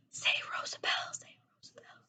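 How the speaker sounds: soft unvoiced whispered wishing. A narrator's reading. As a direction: breathe the wish under your breath. Secret wish whispered.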